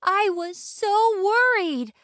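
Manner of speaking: put-on high, slow girl voice; Little Red's voice. Little Red to Granny, high slow girl voice. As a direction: tell her how worried.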